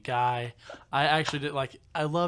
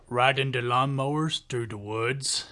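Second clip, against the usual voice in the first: slow, louder imitated country drawl; thick country accent; 6 dB louder than usual. Imitating the coworker naming his fun, thick country accent. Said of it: "he had a real thick country accent".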